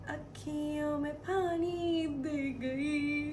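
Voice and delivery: singing through hammed-up sobs, high; mock crying song. Vlogger hams up tears while singing farewell song, mock sobbing. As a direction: sing it while pretending to weep.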